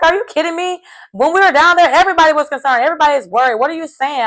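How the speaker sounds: high-pitched mock indignation; indignant protest. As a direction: act out an incredulous protest. Retells accused player protesting.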